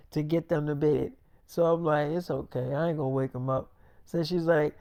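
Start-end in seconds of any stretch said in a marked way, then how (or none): 2.1-3.7 softer, lower hushed answer; hushed reassurance. Retold or imitated. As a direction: keep it down, the babies are sleeping. Babies asleep, hushed reply.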